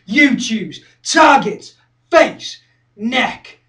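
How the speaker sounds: loud, forceful skinhead rant; aggressive listing. Acted. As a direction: punch out each item loudly. Fighter rattles off his targets.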